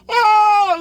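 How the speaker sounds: laughing cry of disbelief. Real, not acted. Reacting to his own lie.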